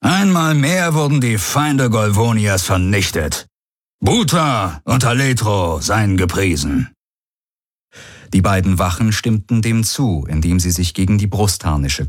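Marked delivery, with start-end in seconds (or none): 0.0-6.9 called out. A narrator's reading. Commander praising victory to his warriors, ringing proclamation. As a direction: proclaim it with solemn fervour. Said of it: "rief er".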